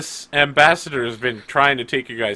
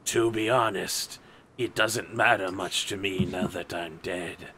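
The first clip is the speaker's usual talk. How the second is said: sneering gloating dark voice; 4 semitones lower, 7 dB quieter than usual. Acted. GM voices dead guard answering.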